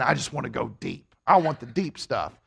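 mock earnest cliché. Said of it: "guilty of saying".

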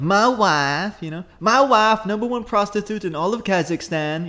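hammy Kazakh Borat boast; Borat accent. Retold or imitated. Host demonstrates Borat, slow Borat accent. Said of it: "Borat's just the".

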